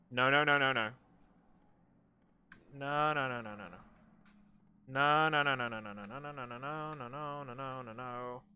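loud then fading frantic denial; panicked no's. Real, not acted. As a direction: beg in rising panic. Panicked repeated no while fleeing.

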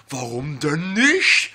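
slow, drawn-out, loud complaint; dragging the question out in disbelief; exasperated. Real, not acted. (gedehnt und genervt)

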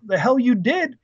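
mock outraged comeback from a parent; retold parental outrage. Parents to son who just enlisted, retold indignant disbelief.

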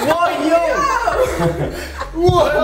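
laughing surprise. Real, not acted. Finding the hidden player.

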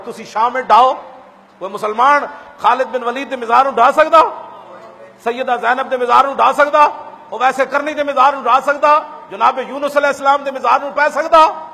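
preaching at full force; fiery preaching. Acted. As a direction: hurl each question at the crowd.

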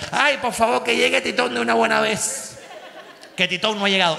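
high, animated Cuban telenovela voice; Cuban accent. Retold or imitated. Imitating a Cuban soap character in a Cuban accent. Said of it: "imitado el acento cubano".

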